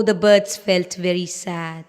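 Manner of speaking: plain reading voice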